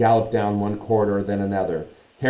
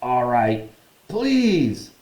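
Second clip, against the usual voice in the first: grudging caretaker; 4 semitones higher, 4 dB louder, much slower than usual. A narrator's reading. Filch giving in grudgingly.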